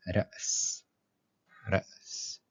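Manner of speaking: ra's with a clear catch on the hamza, whispered; رأس بهمزة واضحة; glottal stop demo. Shown as a demonstration. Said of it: "Comme par exemple".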